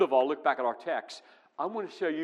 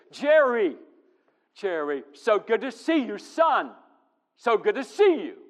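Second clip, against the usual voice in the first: higher, louder, beaming greeting to a son; joyful father's welcome; 8 semitones higher, 9 dB louder than usual. Retold or imitated. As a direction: call out to him like a proud, delighted dad. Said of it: "Big smile across his face".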